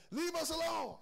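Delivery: high, loud demon shriek; demon yelling. Acted. Preacher voicing the demon crying out to Jesus, shrieking. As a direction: screech it like the devil inside him. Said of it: "yell out and say".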